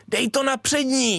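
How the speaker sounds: high, drawn-out call to a teammate; re-enacted pitch call. Player calling a corner kick to teammates, calling out.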